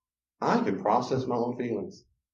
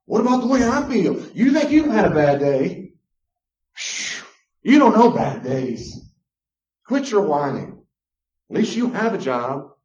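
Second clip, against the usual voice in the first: high, loud, scornful ranting spouse; mock them loudly, much higher and louder; mock dismissive rant; 6 semitones higher, 8 dB louder than usual. A spouse belittling a partner's bad day, loud scornful rant. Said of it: "Discount their feelings".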